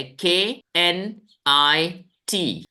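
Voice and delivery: slow letter-by-letter spelling. Shown as a demonstration. Teacher spelling a word aloud to students, slow letters. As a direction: spell each letter slowly for the class. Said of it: "spelling क्या है?".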